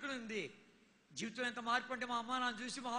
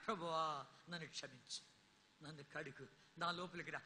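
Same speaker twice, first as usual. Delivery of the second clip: lower, soft humble plea; penitent prayer; 6 semitones lower, 6 dB quieter than usual. Acted. Preacher voicing a sinner's prayer, soft and humble. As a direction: murmur it like a confession.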